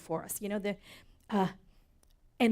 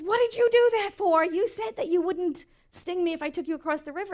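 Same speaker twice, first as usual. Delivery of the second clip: much higher, louder, indignant cry; outraged frog; 8 semitones higher, 6 dB louder than usual. Acted. Dying frog cries out at the scorpion, shrill and loud. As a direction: shriek it like a betrayed frog. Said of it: "And the frog said".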